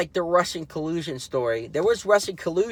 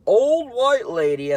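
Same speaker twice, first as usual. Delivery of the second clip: mocking quote; 8 semitones higher, 5 dB louder, slower than usual. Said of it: "I think that's his exact quote".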